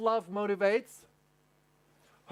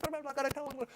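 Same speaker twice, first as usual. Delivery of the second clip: higher, breezy mock chatter; chattering wife; 9 semitones higher, 3 dB quieter, faster than usual. Wife with words to use up, mock chatter in a higher voice. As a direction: rattle it off as the eager wife. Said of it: "She's like".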